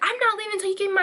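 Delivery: high, rushed mock protest; irate customer refusing. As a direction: imitate a customer digging in, fast and high. Retold customer refusing to leave.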